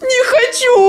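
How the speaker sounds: crying shout in fear; real frightened shout; panicking gamer. Real, not acted. Scared player refuses to hide.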